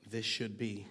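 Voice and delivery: normal talking voice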